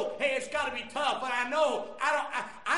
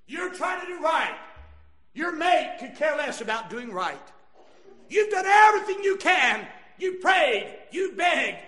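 raised, forceful pulpit delivery; impassioned preaching; 4 semitones higher, 8 dB louder than usual. Real, not acted. Preacher to the faithful spouse with a careless mate, impassioned preaching. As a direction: drive each phrase home to the congregation.

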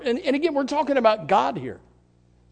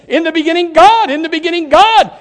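preaching refrain; 5 semitones higher, 6 dB louder than usual. Acted.

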